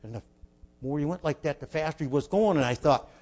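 ordinary talk, nothing marked

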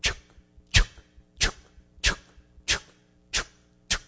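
short, evenly spaced chuk sounds; steam train chuffing. Retold or imitated. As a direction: percussive chuffs with a pause between each. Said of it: "that thing says".

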